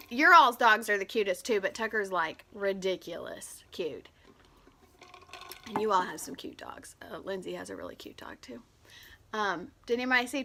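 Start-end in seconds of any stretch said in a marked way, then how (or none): 2.1-6.9 whisper. Real, not acted. Whispered aside about dog. 7.0-8.6 whispered aside. Real, not acted.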